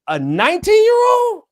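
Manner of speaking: high, loud disbelieving question; incredulous. Real, not acted. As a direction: shoot the pitch up in disbelief. Incredulous at a peer's choice.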